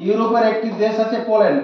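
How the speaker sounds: usual speaking voice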